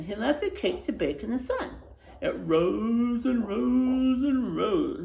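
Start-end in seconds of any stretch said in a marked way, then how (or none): 2.2-5.0 slow, stretching each rose; drawn out. A narrator's reading. Storyteller stretching the growing cake, slow. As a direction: let it rise slowly and slowly.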